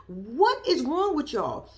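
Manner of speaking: dragged out slowly in disbelief. Real, not acted. Repeating the question to the family, slow and disbelieving. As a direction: let each word drop slowly, shaking your head.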